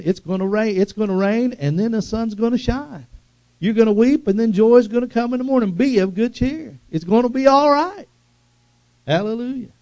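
building revival preacher cry; preaching crescendo. Acted. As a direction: lift the congregation to joy. Preaching builds to full force.

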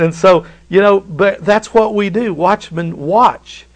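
normal talking voice

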